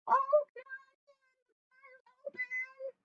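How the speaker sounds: very high, slow granny falsetto; Grandma. Acted. Grandma lets the visitor in, high and slow.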